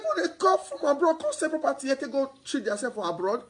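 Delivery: ordinary talk, nothing marked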